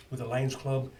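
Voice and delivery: usual speaking voice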